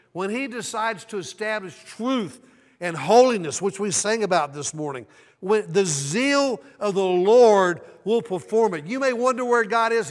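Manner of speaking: loud, high, rising preacher's build; preaching at full force. Acted. Preacher warns the congregation God is coming, full-force preaching. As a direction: drive it home to the congregation.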